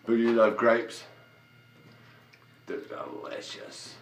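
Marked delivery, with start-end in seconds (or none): 2.7-4.0 1 whisper. Real, not acted. Aside to camera.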